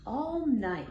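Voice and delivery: ordinary delivery, nothing marked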